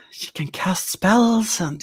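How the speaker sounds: fearful whisper. Acted. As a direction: whisper it like a secret. NPC whispers about witch.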